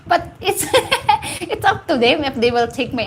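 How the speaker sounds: chuckling all through the line; half-laughing, amused at herself. Real, not acted. To viewers about her advice, laughing.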